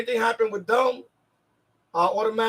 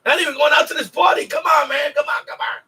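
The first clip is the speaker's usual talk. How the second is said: mock pushy friend urging, louder; pleading coax; 5 semitones higher, 3 dB louder than usual. Friends urging Danny to the party, pushy coaxing. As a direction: coax him like a pushy buddy.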